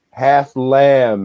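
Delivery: ordinary delivery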